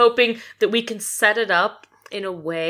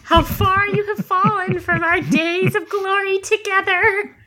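high, theatrical, laughing-crying speech; grand tragic send-off; dramatic villain farewell; 10 semitones higher than usual. Acted. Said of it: "she'll say".

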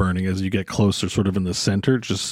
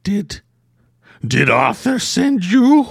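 high, slow, nervous question; odd hermit voice; 10 semitones higher, slower than usual. Acted. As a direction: ask timidly through the door crack. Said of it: "and it says".

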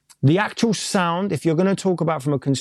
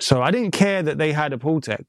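ordinary talk

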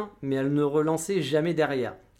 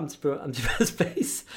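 laughing. Real, not acted.

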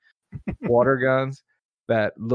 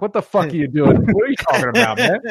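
mock confused retort; 8 semitones higher, 4 dB louder, faster than usual. Radio guy confronts Sandler's character.

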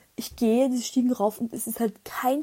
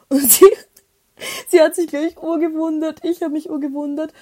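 laughing; 6 semitones higher, 8 dB louder than usual. Real, not acted. Laughing while retelling.

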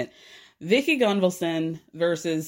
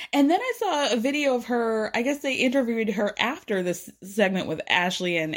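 ordinary talk

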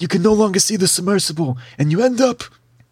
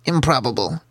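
low, measured creature voice; Snurbervlin; 6 semitones lower than usual. Acted. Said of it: "hear the Snurbervlin say".

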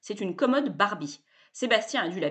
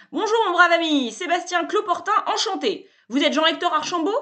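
higher and louder, pushy glad-handing pitchman; loud, high, cheerful bluster; brash salesman; 9 semitones higher, 7 dB louder than usual. A narrator's reading. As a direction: barge in like an overfamiliar hustler.

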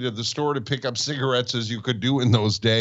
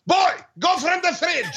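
put-on thick German accent, pitched way up. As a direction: bark it like a gruff German shopkeeper. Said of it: "he spoke with a very thick German accent".

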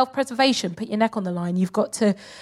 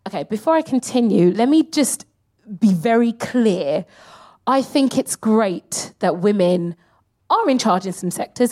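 normal talking voice

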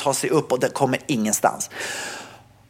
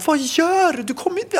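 retold panicked cry; 13 semitones higher than usual. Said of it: "Jag bara så här".

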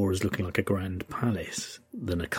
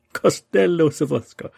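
Costello Sorfesco with a hammed Italian accent, much higher and louder; loud playful Italian-accented Costello Sorfesco; 12 semitones higher, 13 dB louder than usual. Shown as a demonstration. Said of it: "If you say it with an Italian accent".

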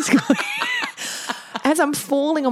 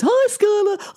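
cheerful greeting; 12 semitones higher, slower than usual. Retold or imitated. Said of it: "And Kai goes".

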